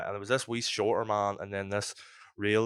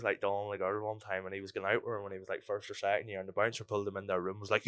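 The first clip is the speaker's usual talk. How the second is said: ordinary talk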